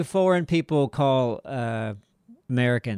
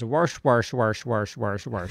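mock American accent; 5 semitones lower, slower than usual. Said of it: "when his mom does the American accent".

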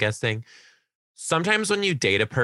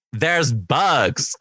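loud, higher mock protest; kid's aghast outburst, retold; exclaiming student; 6 semitones higher, 5 dB louder than usual. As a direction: imitate a student's loud complaint. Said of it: "And she goes".

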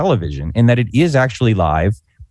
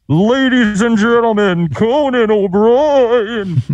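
big booming announcer voice, drawn out; Don Pardo announcer; 10 semitones higher, slower than usual. Retold or imitated. Retelling Don Pardo introducing Conan, booming announcer call. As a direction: stretch the name like a game show host. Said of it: "I hear Don Pardo go".

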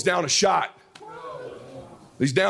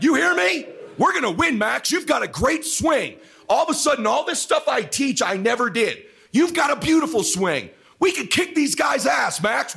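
high, intense rallying cry; fired-up pep talk; 8 semitones higher than usual. Retold or imitated. Dad rallying son before golf, fired-up high-pitched urging. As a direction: pump him up at full intensity.

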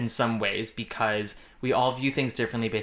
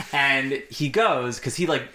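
usual speaking voice

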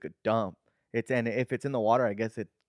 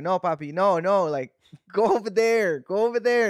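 put-on higher, louder whiny toddler pushing dad away, with a laugh; imitating toddler; 6 semitones higher, 5 dB louder than usual. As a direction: play the little kid saying go away. Toddler voiced refusing his dad.

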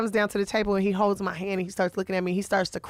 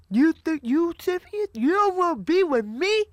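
higher-pitched, incredulous imitation of a rejected man; mock indignant disbelief; 7 semitones higher than usual. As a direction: act out wounded pride rising in pitch. Acting out rejected Roscoe, escalating.

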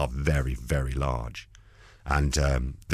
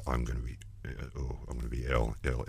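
queasy hangover moan; 5 semitones lower, 6 dB quieter than usual.

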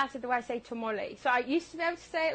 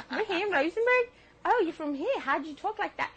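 retold exchange; 6 semitones higher than usual. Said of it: "I'm like".

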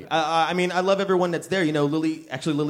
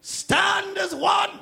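high, slow shouting rally voice; booming rally speech; 13 semitones higher, slower than usual. Acted. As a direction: rouse the crowd like a demagogue. Rallying the planet against aliens.

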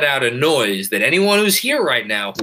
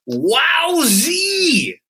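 high excited squeal. Real, not acted. Reading out a super chat.

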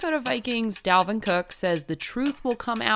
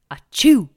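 fake sneeze; 11 dB louder than usual.

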